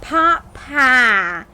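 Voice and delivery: mock Southern TV cook, drawn out; Paula Deen. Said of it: "imagine Paula Deen being like".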